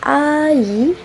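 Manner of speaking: "ai" held long, gliding up high; demonstrated diphthong. Shown as a demonstration. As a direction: stretch "ai" from open ah into ee. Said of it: "The first diphthong is".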